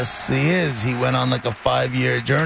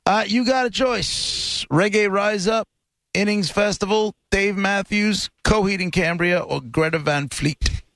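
announcer voice; 5 semitones higher than usual. Real, not acted. Radio host giving prize choices.

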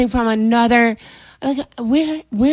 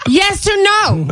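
high-pitched, fed-up questioning; exasperated demand; 10 semitones higher, 3 dB louder, slower than usual. Retold or imitated. Girlfriend pressing boyfriend for an answer, exasperated.

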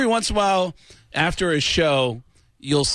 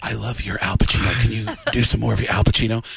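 low, husky voice with deliberate pauses; Alec Baldwin impression; 7 semitones lower than usual. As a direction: ask for it in a deep, hushed leading-man voice. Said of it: "He'd always knock on my door every day and go".

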